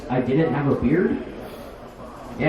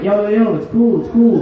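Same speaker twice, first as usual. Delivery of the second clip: flustered reassurance; 4 semitones higher, 7 dB louder than usual. Retold or imitated. Calming the girl.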